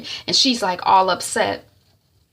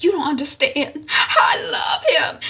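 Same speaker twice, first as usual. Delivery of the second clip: high wail building louder and slower; tearful pleading; 8 semitones higher, 4 dB louder than usual. Retold or imitated. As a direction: plead as a lovesick sister. Daughter pleads in quarrel.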